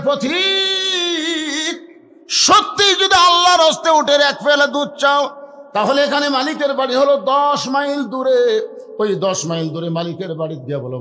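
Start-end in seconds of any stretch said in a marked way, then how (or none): 0.0-1.8 long high held call. Acted. Shepherd calling out to traveller. 2.3-8.7 loud, high preacher's declamation; ringing high acted speech; declaimed challenge. Shepherd declaiming to the traveller.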